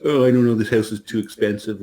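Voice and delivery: high whiny complaining client. Retold or imitated. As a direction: whine it like an indecisive buyer. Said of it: "they imitate, like, a whiny voice".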